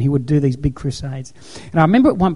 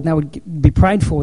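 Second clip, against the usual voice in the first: ordinary delivery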